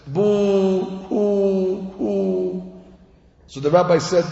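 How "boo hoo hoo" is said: three drawn-out, sustained boo-hoos; mock crying, each hoo held long; long wailing cry. Shown as a demonstration. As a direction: imitate a long cry in three even wails. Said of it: "they cry like this".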